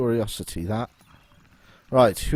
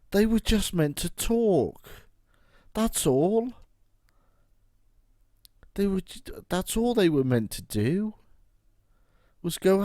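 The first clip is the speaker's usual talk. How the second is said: dismayed protest; 8 semitones higher than usual. Real, not acted. Player complaining the villagers attacked instead of talking. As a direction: plead with the game it wasn't meant to be.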